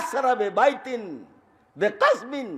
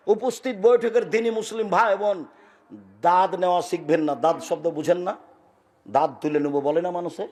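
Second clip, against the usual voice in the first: ordinary talk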